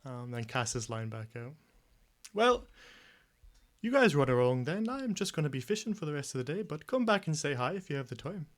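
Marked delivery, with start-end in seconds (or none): 2.3-8.5 higher, chatty village fisherman voice; fisherman Weissman. Acted. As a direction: play the friendly old fisherman, pitched up.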